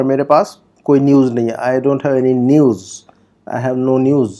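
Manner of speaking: usual speaking voice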